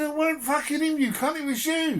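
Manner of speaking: high, laughing mock outrage; laughing retort. Snapping back at his brother, high and laughing. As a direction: throw it back at him through laughter.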